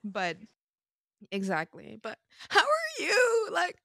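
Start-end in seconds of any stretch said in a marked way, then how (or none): 2.0-3.8 playful high voice. Real, not acted. Turning the talk back to a friend, playful high voice. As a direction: swoop up high and stretch it out.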